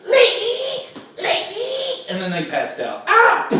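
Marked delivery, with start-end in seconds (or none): 0.1-2.1 very high squealed cries; comic high-pitched fright; frightened Cub Scouts. Acted. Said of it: "poor little Cub Scouts were frightened". 3.1-3.5 short, very high loud cry; comic high faint cry; fainting shriek. Acted. Cub Scouts pass out.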